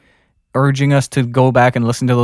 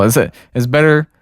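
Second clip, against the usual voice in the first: ordinary delivery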